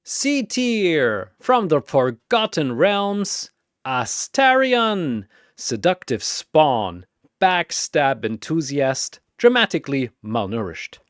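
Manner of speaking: boxing announcer calling fighters; arena announcer. Acted. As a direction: hype each fighter like a ring announcer.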